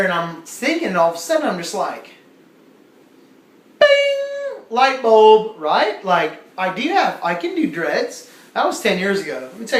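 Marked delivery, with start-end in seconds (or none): 3.8-4.6 long, high, loud bing; imitated bell ding. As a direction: ring out like a sudden bright idea. Imitates idea suddenly striking.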